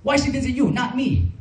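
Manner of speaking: put-on high, jealous Vietnamese-accented mother; upset mother questioning her son about a dream, high and accented; imitating upset mom. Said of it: "She was like".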